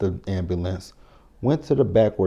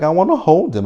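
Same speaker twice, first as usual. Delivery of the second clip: higher, louder mock plea to hold the baby; mock pleading Anna; 8 semitones higher, 6 dB louder than usual. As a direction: whine it like a new mother. Anna begging after giving birth.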